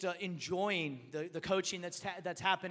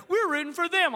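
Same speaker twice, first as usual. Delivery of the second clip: put-on higher, louder kids' cheer; imitated kids; 9 semitones higher, 9 dB louder than usual. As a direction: mimic boys jumping on the bandwagon. Imitates his boys picking a team.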